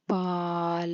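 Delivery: പാല sounded out clearly, paa then la, as a model; careful demo reading. Shown as a demonstration. Teacher modelling the word for pupils, careful. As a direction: read പാല slowly for the class to copy. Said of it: "എന്നാണ് വായിക്കുന്നത്".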